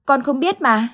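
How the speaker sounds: put-on a scared kid trying to explain a nightmare; panicked child. Boy telling Uncle Tư his dream, panicked child voice. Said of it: "thái độ hết sức hoảng loạn".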